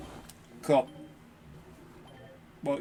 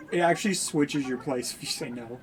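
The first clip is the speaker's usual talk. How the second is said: usual speaking voice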